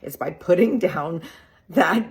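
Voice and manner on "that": chuckling through the words, real amusement; laughing. Real, not acted. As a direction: laugh as it hits home. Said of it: "sorry that I'm laughing".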